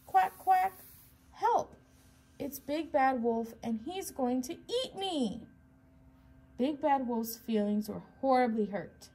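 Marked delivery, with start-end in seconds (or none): 0.1-5.5 high-pitched plea for help; frightened duckling cry. A narrator's reading. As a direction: squeal in alarm like a tiny duckling. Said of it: "cried number five".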